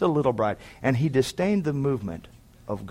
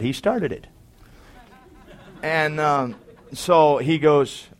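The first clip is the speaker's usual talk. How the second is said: normal talking voice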